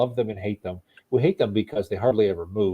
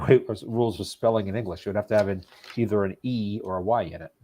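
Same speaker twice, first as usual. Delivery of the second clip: ordinary delivery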